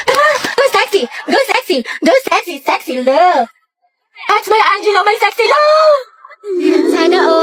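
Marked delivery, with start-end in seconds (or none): 0.1-6.0 high, swooping fangirl chant; excited high-pitched cheering; gushing cheer. Acted. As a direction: cheer your crush on flirtatiously. Cheering on her crush at basketball.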